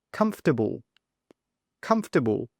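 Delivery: "comfortable" as KUMF-tuh-bul; first-syllable stress. Shown as a demonstration. Teacher models a further example word, stress on COMF. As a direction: punch COMF and reduce the rest of "comfortable". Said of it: "The stress is on the first".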